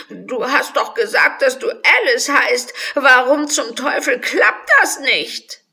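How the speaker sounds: loud, high, frustrated crying out; angry tearful. Acted. The crying unicorn scolds Alice, loud and frustrated. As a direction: wail in furious frustration. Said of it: "Das Einhorn war wütend, Tränen kullerten".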